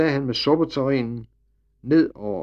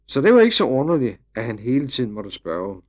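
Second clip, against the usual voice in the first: plain reading voice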